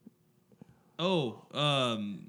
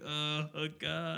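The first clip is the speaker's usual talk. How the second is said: slow, softer dawning dread; mock panic; 5 dB quieter, much slower than usual. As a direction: imitate a friend's sinking panic. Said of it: "The panic in his face".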